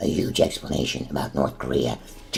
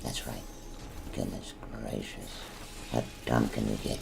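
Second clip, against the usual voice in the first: normal talking voice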